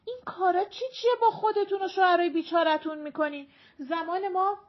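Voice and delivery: high, loud, fast elder's reproach; disapproving scolding. A narrator's reading. Older woman scolding younger wives, disapproving. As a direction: lecture the younger ones indignantly.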